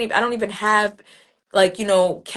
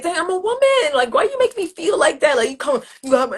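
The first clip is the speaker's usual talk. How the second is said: offended; 8 semitones higher, 5 dB louder than usual. Retold or imitated. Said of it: "I'm like".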